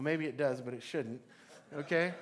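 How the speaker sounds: ordinary talk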